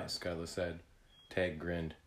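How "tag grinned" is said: ordinary delivery, nothing marked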